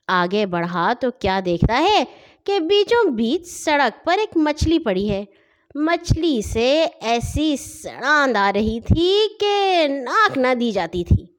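ordinary delivery, nothing marked

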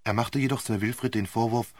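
plain reading voice

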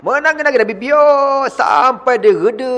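normal talking voice

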